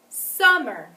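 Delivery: "Summer" with a loud, long first syllable; stress on first syllable. Shown as a demonstration. Teacher asks pupils to hear the accent, stressed "Summer". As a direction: punch and stretch the start of "Summer", then fall. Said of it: "it was louder, longer, and higher on the first syllable".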